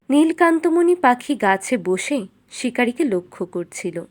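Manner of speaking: ordinary delivery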